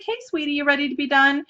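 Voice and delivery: sweet mom voice. Retold or imitated. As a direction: gently check in with a small child. Mother to child at bathtime, retold.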